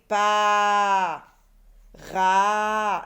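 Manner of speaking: PAS and RA held long and loud on the A; stretched vowel. Shown as a demonstration. Teacher lets children hear A, PAS and RA loudly stretched. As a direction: hold out the A in PAS, then RA. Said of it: "Est-ce que j'entends A dans ra".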